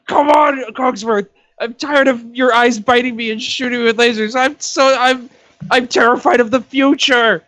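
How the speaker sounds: put-on high, quavering old man pleading; frightened old man begging his companion. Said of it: "you're an arthritic old man".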